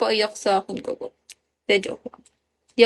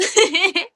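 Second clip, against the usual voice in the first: giggle; 5 semitones higher than usual. Real, not acted. Laughter continues.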